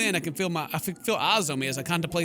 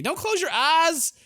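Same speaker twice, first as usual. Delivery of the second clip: much higher, louder, slow and pleading; yelling at the screen; 13 semitones higher, 6 dB louder, slower than usual. Real, not acted. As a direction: warn the hero with a frantic shout. Yells at the character.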